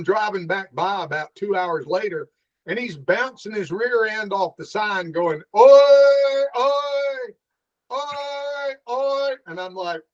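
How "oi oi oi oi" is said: high, monotone repeated buoy horn; imitated buoy warning noise. Man bouncing off a sign as a buoy, honking. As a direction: honk it out high, first one loud. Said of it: "what's that noise you're making".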